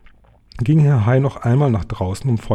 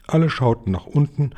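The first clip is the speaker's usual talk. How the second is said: plain reading voice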